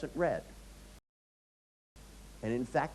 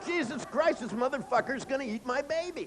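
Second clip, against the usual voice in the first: high, frantic cry of a mother whale; panicked whale voice; 10 semitones higher than usual. Retold or imitated. Mother whale panicking that the shark will eat her baby, high frantic cry. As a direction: shriek it out in terror for the baby.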